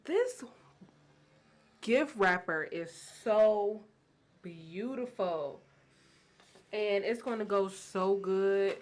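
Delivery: gushing, drawn-out delight with emphatic peaks; real excitement. Real, not acted. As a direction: savor it excitedly, stretching the key words. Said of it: "if you can hear the excitement in my voice".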